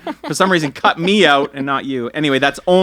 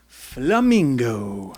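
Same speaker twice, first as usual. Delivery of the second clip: mock Spanish accent; 4 semitones lower, 4 dB quieter, much slower than usual. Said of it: "That's not how you say flamingo in Spanish".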